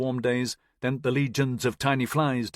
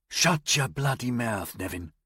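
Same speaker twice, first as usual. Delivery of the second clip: a hint of a gruff soldier, slow. Sergeant silencing Nevin from behind, lightly gruff. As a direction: cut him off, lightly gruff. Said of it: "a gruff voice behind him said".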